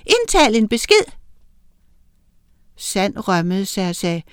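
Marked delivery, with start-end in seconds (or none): 0.0-1.1 much higher recorded greeting; answering machine. A narrator's reading. Lene's answering machine greeting the caller, recorded voice. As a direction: sound like a canned phone message.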